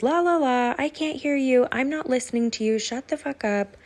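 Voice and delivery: high, bratty la-la-la, fingers in ears; mocking toddler tantrum. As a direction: mimic a mom acting like a toddler. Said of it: "like a toddler throwing a tantrum and goes".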